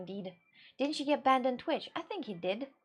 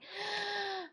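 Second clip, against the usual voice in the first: high breathy gasp of sudden idea; playful gasp; mock excited gasp; 9 semitones higher, 7 dB quieter than usual. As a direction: gasp like an idea just struck. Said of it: "I'm like".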